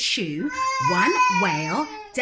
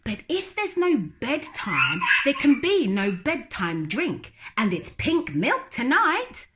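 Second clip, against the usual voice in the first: higher, chuckling tempting brother; lightly voiced brother with a laugh; 6 semitones higher, 3 dB louder than usual. Brother tempting Lola with pink milk, chuckling. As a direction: tempt her with a smile.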